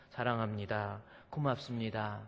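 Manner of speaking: soft, tender thanks to a wife; warm. Shown as a demonstration. Pastor showing husbands how to speak to their wives, warm and soft. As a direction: say it gently and lovingly. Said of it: "남편으로서 따뜻하게".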